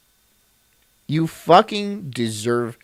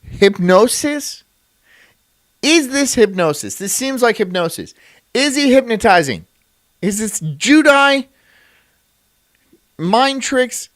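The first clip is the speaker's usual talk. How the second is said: excited; 7 semitones higher, 4 dB louder than usual. Real, not acted. Reacting to a hypnosis clip.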